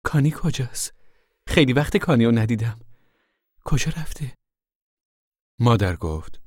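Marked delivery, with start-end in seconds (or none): 0.0-4.3 higher, anxious questioning; worried. A narrator's reading. Rose of Sharon asks where her missing man is, worried. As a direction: ask urgently, worried and high-pitched. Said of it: "با لحنی نگران پرسید".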